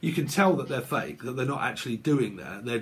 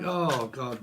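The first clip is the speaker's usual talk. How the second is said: ordinary talk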